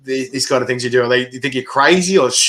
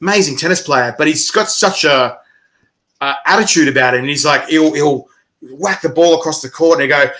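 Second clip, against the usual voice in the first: ordinary talk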